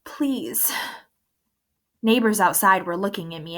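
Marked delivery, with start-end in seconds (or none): 0.0-0.7 voice breaking. A narrator's reading. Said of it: "The words began to break up in my throat".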